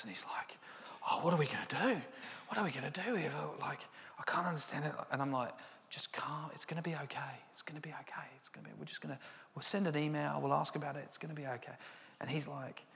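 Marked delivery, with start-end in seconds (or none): 1.1-4.9 high, strained panic, dropping to a whisper; panicked colleague. Retold or imitated. Panicked colleague asking what to do, high then hushed. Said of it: "he went into panic mode". 5.9-11.7 soft, hushed reassurance; calming whisper. Retold or imitated. Boss calms his panicking colleague in a soft hushed voice.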